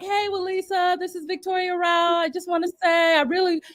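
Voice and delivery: high, soft, polite message voice; soft high voice of Victoria Rowell; Victoria's voicemail. Retold or imitated. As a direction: leave a gracious phone message, softly and high. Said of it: "She called me up and was like".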